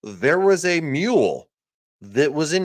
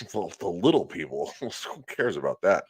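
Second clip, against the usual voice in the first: laughing mockery; 6 semitones lower, 4 dB quieter than usual. Mocking elites, laughing.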